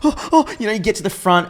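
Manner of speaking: very high-pitched jittery gasps; mock nervous. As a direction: act out a nervous youngster, hammed up. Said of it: "I was that fucking nervous".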